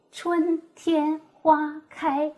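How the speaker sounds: 春天花开 each syllable held high and level; first tone demo. Shown as a demonstration. Teacher demonstrating the level first tone. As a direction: sound out 春天花开 on a steady high pitch. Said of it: "这四个音节的声调".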